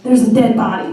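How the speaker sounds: loud, insistent announcement down the phone; retold alarm. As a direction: report it in comic panic. Retold panicked phone call.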